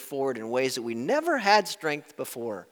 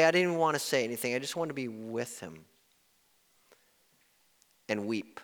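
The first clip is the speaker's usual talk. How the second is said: normal talking voice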